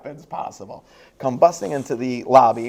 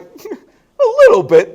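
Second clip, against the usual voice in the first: mock understatement; 12 dB louder, much slower than usual. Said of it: "I'm like".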